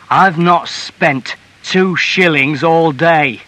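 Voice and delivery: slow, loud, emphatic protest; indignant husband; angry denial. A narrator's reading. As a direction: deny it forcefully, word by word. Husband defends himself in quarrel.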